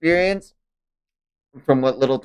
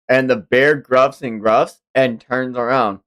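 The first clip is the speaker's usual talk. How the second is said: usual speaking voice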